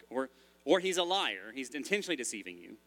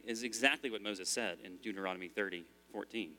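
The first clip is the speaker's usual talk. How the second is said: normal talking voice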